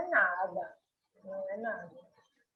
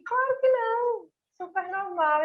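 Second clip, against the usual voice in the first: casual; 10 semitones higher than usual. Retold or imitated. Quoting hosts' casual reply.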